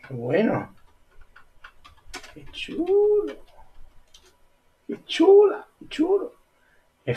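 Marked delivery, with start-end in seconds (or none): 2.3-6.3 squeaky thrilled gushing; high delighted gush. Real, not acted. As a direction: coo over it in a very high voice. Gushing over the display.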